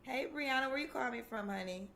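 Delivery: ordinary delivery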